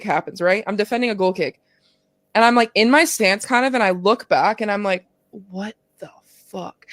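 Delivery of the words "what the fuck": slow, hushed, drawn-out shock; stunned disbelief. Retold or imitated. Player spotting someone while on the field, stunned. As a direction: let it fall out slowly under your breath. Stunned reaction on seeing her dad.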